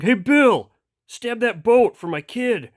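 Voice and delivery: high, loud shout to a coworker; calling logger. Acted. Logger yelling to Bill to stab the boat. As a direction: holler across the river.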